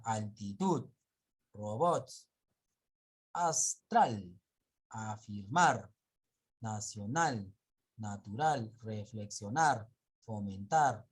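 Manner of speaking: each word punched loud on its final syllable, as in altiTUD and fomenTAR; slow example words, hammer the ending of altitud, astral, fomentar; altitud, robots, nacional, each landing hard at the end; stress on last syllable. Shown as a demonstration. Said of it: "la mayor fuerza de voz lleva en la última sílaba".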